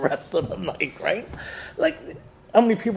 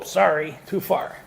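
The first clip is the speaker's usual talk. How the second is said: ordinary delivery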